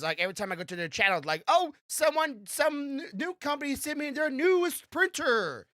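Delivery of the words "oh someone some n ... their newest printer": mock youtuber voice. Mocking youtuber announcing free printer.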